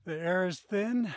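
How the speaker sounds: breathless at high altitude; winded from the climb; out of breath. Real, not acted. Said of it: "catching our breath".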